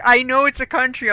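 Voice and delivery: mock indignant retort. Teller correcting her friend's assumption, indignant retort. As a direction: fire back in mock indignation.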